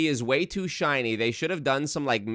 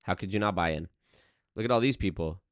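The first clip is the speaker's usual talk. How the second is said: ordinary talk, nothing marked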